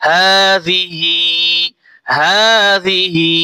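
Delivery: slow, melodic tajweed recitation; Quran recitation. Acted. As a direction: recite the full verse with tune. Recites slowly.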